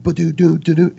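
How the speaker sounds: high, sung-out babble of syllables; imitated popping-back noise. Man imitates himself coming back out, high playful sound effect.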